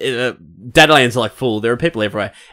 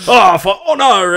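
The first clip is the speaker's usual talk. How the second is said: high, loud, drawn-out mock oath; slow hammed-up chivalrous cry; poncy knight; 9 semitones higher, 5 dB louder, slower than usual. Mimicking knights of Toussaint, poncy storybook declaration. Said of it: "they're all poncy and everything".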